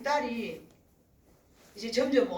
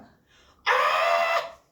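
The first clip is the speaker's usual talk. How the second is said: imitated baby shriek; 10 dB louder than usual. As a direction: belt out a baby's piercing yell. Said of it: "자기 의사 표현을 그렇게 하는".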